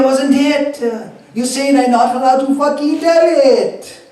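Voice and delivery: very high, loud, dramatic Jamaican Rasta outcry; Rastafari accent. Acted. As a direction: throw up your hands and protest in Rasta voice. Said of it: "my Rastafari accent".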